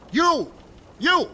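sharp call out. Acted. Tribune singles out a rower.

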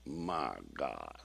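low, soft awe; awed hush. Retold or imitated. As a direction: breathe it out in deep awe. Teenager awed by the verse.

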